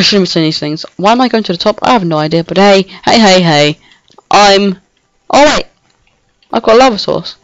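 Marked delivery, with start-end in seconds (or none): none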